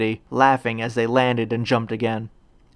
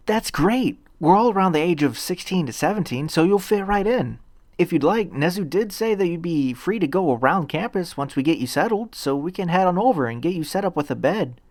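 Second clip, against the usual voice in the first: lightly voiced eager boy, a bit higher; Deku's voice; 6 semitones higher than usual. A narrator's reading. Deku telling the new girl about the dorms, lightly voiced and a bit higher. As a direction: explain it kindly as the nervous young hero.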